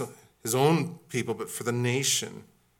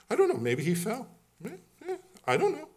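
squeaky feigned ignorance; 13 semitones higher, faster than usual. Acted.

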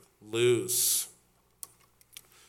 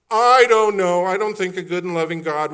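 mock doubt; 7 semitones higher, 10 dB louder than usual. Pastor voicing a sceptic, mock-doubtful. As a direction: play the sceptic with a shrug.

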